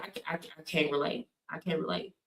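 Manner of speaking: quiet self-mumble of "can't relate"; muttered. Shown as a demonstration. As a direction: drop the voice, almost to yourself.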